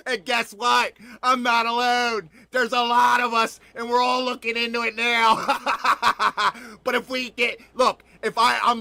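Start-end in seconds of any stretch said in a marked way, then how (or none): none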